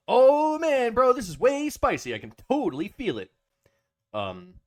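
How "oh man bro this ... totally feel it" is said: high, loud, hammed-up bro voice. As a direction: cry out like an overwhelmed bro, easing off at the end.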